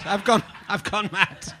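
ordinary talk

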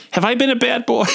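high-pitched mock-coy question; playful flirting.

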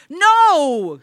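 high, loud refusal; hysterical cry. A narrator's reading. Wife refuses her husband, crying out hysterically. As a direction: cry it out, beside yourself. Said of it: "she cried hysterically".

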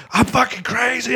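high-pitched mock scream; crazed cry at moderate volume; retold screaming. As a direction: act out a crazed yell, pitch way up. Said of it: "And I'm screaming".

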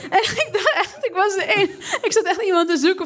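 talking through giggles; laughing. Real, not acted. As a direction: laugh while telling it. Telling a funny street scene.

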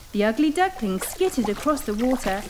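ordinary delivery

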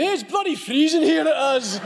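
high, loud hammed-up Scottish accent; mock Scottish accent, laughing; Scottish Trotsky. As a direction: bellow it like a freezing Scotsman. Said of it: "Trotsky Scottish going".

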